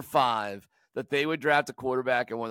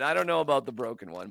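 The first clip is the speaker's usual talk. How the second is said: normal talking voice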